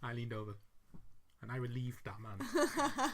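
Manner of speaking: low, soft, slowing confession; mock-solemn, voice dropped right down; grave confession. Dad in his interview chair, mock-grave confession. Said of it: "in his little interview chair and he goes".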